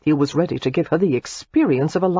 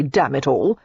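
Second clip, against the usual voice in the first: normal talking voice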